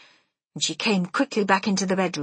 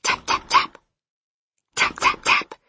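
whispered tapping noise; tapping sound; 3 dB louder than usual. A narrator's reading. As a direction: tap out the knock with your breath. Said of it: "tap, I went".

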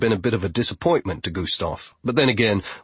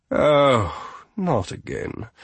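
pouting; 3 semitones higher, slower than usual. A narrator's reading. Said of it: "crossing her arms and pouting".

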